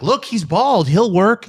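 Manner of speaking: high, loud mock-obvious exclamation; mock eureka. Host imitates the snap casting reaction, mock eureka. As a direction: call it out like an instant casting brainwave.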